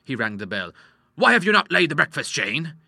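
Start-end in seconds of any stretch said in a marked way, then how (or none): 1.1-2.7 louder, higher reproachful question; scolding. A narrator's reading. Doctor calling the maid to account, scolding. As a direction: take the servant to task.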